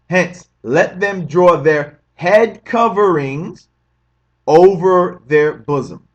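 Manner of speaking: measured, deliberate verse reading; slow scripture reading. Acted. Reading a Quran verse to brothers and sisters, slow and measured. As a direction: read each phrase slowly, like scripture.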